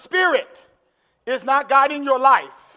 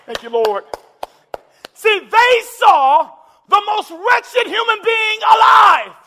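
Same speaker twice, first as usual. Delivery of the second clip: loud, slowing, climbing preacher's cry; rising sermon climax, loud and drawn out; full-force preaching; 7 semitones higher, 5 dB louder than usual. Acted. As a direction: preach it to the rafters.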